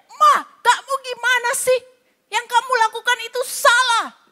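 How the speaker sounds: high-pitched scolding yell at his wife; mock a husband blowing up; angry shout. An angry husband yelling at his wife, hammed-up high shout. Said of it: "Dia langsung teriak".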